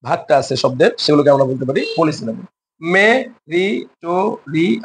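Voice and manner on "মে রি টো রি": "মে, রি, টো, রি" in detached, counted beats; syllable by syllable. Shown as a demonstration. Teacher counting syllables of a long word, "মে, রি, টো, রি" split. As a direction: pause after each of "মে, রি, টো, রি". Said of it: "এখানে এক, দুই, তিন, চার".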